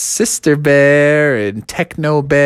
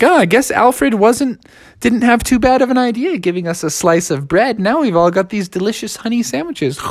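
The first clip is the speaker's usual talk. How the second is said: bears' voice; 8 semitones higher than usual. Acted.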